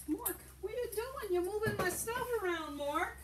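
high, soft, quick chiding; mild scolding. Real, not acted. Seller to Mork moving the stuff around, gently scolding.

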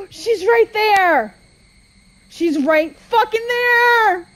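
high, loud, drawn-out cry of alarm; panicked cry. Real, not acted. Player seeing her right there, panicked cry. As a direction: cry out slowly in real panic. Sudden enemy sighting in horror game.

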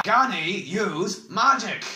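slow, loud, high roar. A narrator's reading. Oric cutting off the bald man's evasion, roaring. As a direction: bellow the question word by word. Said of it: "roared Oric, his deep voice echoing".

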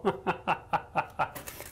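soft, trailing chuckle; relieved laugh. Real, not acted. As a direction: keep chuckling at your own near miss. Laughing at his near miss.

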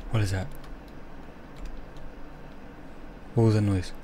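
soft, wary questioning; hushed, near whisper. Real, not acted. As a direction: keep it down so nothing hears. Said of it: "Why am I whispering?".

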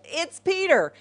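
acted burst of glad recognition; delighted recognition. Servant girl knows the voice at the door, delighted.

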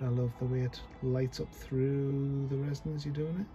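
ordinary talk, nothing marked

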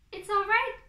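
loud, high reassuring shout; shouted reply. A narrator's reading. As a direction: call back from up the tree. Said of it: "Fox shouted back".

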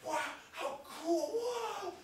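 high, loud, slow wow building to a screaming woo; ecstatic scream. Retold or imitated. Hiker filming the rainbow, screaming in ecstasy. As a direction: lose it completely in awe. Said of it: "he would scream like that".

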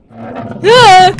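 sudden high scream of fright; startled scream. Real, not acted. Player screams as the monster appears, startled. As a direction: shriek at the jump scare. Jump scare in horror game.

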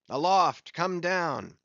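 calling aloft. Acted. Ahab calls aloft.

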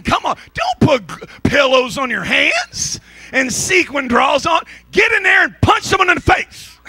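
high, rising preacher shout; rally them, voice climbing up high; preaching shout. Real, not acted. Preacher urging the congregation in a high shout.

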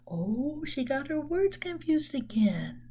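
softly whispered, confiding to the class; whispered aside. Real, not acted. Teacher whispering an aside to the listening children.

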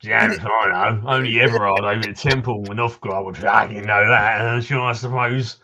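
put-on townsman in a Cockney accent, gruff and chatty; grumbling Cockney local greeting a stranger; Cockney NPC. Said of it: "for the accent compliment".

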